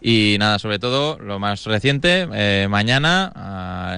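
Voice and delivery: ordinary delivery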